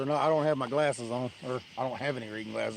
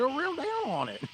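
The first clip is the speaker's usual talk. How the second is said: mock excited urging; 13 semitones higher than usual. Retold urging friend on bite.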